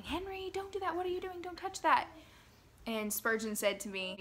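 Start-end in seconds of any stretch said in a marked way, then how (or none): 0.0-2.1 high-pitched mock shout, held back in volume; imitating an urgent shout at a child; retold shout. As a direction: call out a scolding in a raised pitch, re-enacted. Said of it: "so I was shouting".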